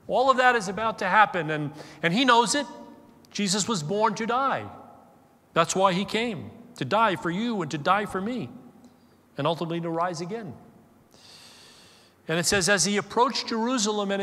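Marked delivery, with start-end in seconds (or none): none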